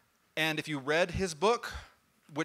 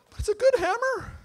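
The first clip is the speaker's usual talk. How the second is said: whiny plea; 4 dB louder than usual. Acted. Thor pleading with Freya.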